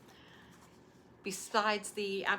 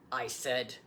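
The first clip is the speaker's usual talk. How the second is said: a patient grandfather answering; grandfather's voice; 11 dB louder, slower than usual. Acted. Grandfather recalls his words.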